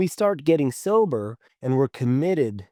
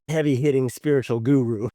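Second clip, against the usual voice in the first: ordinary talk, nothing marked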